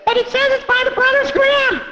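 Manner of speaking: put-on high kid voice picking at details; a sharp little kid arguing; quibbling child. Child arguing with the reader about the quotes, high kid voice.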